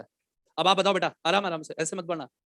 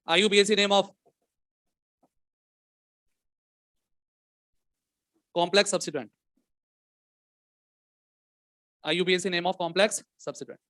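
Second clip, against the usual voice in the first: slow dictation; much slower than usual. Acted. Teacher dictates the next question.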